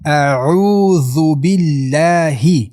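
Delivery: very slow 'اعوذ بالله', ending in distinct hi; clear final hi. Shown as a demonstration. Teacher models the final kasra, slow and clear. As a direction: draw out 'اعوذ بالله' and sound the hi. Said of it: "این را حتماً باید روشن کنیم".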